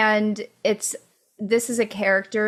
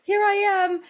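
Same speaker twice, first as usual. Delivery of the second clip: inner voice; 7 semitones higher than usual. Retold or imitated. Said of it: "And so I was like".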